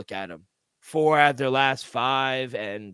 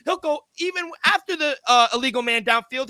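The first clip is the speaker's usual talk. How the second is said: ordinary talk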